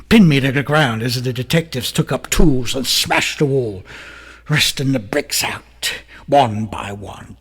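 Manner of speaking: ordinary talk, nothing marked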